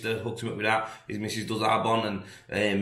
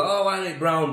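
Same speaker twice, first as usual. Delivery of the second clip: mocking question; 7 semitones higher, 4 dB louder than usual. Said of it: "I know people go".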